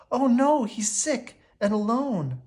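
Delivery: high, slow anguished worry; distressed cry. A narrator's reading. Belle seeing her father lost, distressed. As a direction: voice full of fear for her father. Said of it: "cried Belle".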